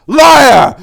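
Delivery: high, loud furious yell; screaming fury; furious call. A narrator's reading. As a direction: hurl the accusation. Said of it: "called out in fury".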